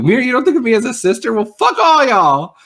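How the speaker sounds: high, loud, offended rant; indignant outburst. Retold or imitated. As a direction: storm off in a huff. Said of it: "she totally would've been like".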